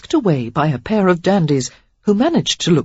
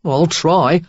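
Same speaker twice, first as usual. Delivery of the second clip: dubious; 5 dB louder than usual. A narrator's reading. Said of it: "Gil said dubiously".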